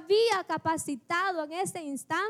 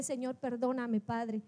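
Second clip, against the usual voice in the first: quiet contrite prayer; 5 semitones lower, 5 dB quieter than usual. Retold or imitated. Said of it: "Me desperté".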